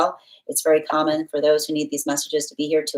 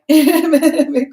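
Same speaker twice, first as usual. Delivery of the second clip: laugh; 10 semitones higher, 10 dB louder than usual. Real, not acted. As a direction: burst of high, loud laughter. Laughs at viewer's name.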